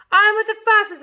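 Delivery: booming high shout. Acted. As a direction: bellow the order from the cloud. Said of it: "it shouted".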